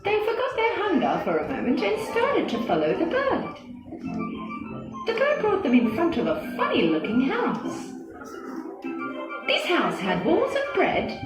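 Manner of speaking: ordinary delivery, nothing marked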